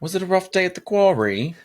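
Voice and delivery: very high, loud posh English question; hammed-up falsetto English accent; mock English accent. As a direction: play a prim English lady. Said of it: "What do they say in England?".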